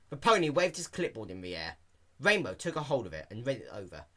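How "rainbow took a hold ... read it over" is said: ordinary delivery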